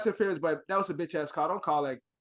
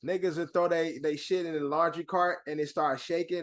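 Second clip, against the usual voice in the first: ordinary talk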